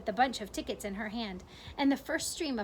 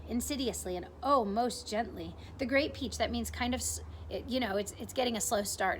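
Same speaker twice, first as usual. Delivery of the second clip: usual speaking voice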